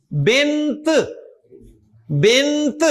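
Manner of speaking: بنت with a clearly released T at the end; audible final T. Shown as a demonstration. Teacher showing students the long ta, بنت with its T sounded. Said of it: "تا کی آواز سنائی دے رہی ہے نا".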